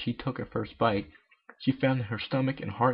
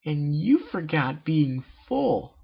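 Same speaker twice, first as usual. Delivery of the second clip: yelp; 5 semitones higher, 4 dB louder, slower than usual. Acted. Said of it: "Pinky yelped".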